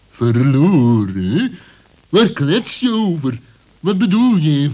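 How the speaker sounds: incredulous. Acted. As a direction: stretch the word in disbelief. Fox repeats, louder and slower.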